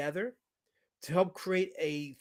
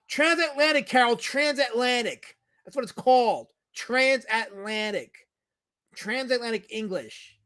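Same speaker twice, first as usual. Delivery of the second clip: raised, loud, drawn-out scolding; exasperated correction; 6 semitones higher, 7 dB louder than usual. Real, not acted. Streamer correcting a viewer on a term, loud exasperation. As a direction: spell it out loudly for someone not listening.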